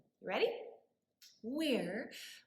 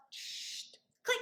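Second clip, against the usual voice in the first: hushed shh then click; imitated buckle click; seatbelt click; 5 dB quieter than usual. Shown as a demonstration. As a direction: make the seatbelt sound softly.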